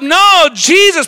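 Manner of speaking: loud, slow, fired-up vow; bold declaration. Retold or imitated. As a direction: proclaim it like you'd fight anyone. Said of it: "Peter declares".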